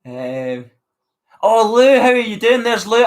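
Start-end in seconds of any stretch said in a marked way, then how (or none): 1.4-3.0 excited greeting. Real, not acted.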